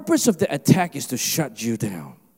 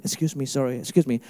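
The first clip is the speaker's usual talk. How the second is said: lower, meek aside; mock Canadian politeness; 4 semitones lower, 5 dB quieter, faster than usual. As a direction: drop to a polite, sheepish apology. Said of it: "imagine if he was Canadian".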